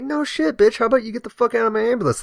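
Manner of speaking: high-pitched exasperated mock tirade; indignant comeback. Fed-up firefighter ordering woman out of ambulance, indignant comeback.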